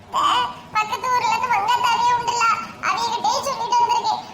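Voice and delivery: high-pitched anxious sibling voice; scared young sibling. Acted. Siblings waiting for mother's call, nervous and high. Plays a frightened sibling figure.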